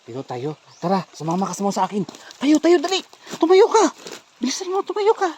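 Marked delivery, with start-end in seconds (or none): 2.4-5.3 high-pitched, pressing commands; raised, insistent urging; urgent urging. Real, not acted. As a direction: hurry someone up onto their feet. Urging her to get up quickly.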